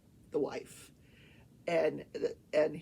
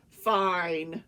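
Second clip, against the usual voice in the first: loud, curt concession; retold grudging consent; 7 dB louder than usual. Judge finally allowing the lawyer time, mock grudging. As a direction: imitate an exasperated judge giving in.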